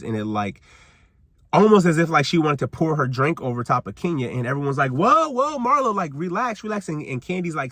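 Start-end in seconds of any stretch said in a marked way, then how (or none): 4.9-6.9 high, urgent whoa; the ladies stopping Marlo's aggressive cheers; alarmed calming. Retold or imitated. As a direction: rush in to calm her down.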